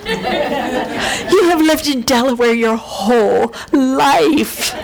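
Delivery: wife teasing husband about the snow; mocking her husband. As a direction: poke fun at him in a lively voice. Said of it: "I was making fun of him".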